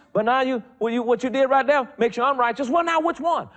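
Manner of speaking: high, loud, fervent preaching; full-force preaching. Real, not acted. Preacher rousing the church against mixing covenants, full force. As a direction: preach it hard and high to the congregation.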